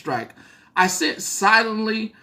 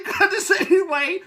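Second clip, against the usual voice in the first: usual speaking voice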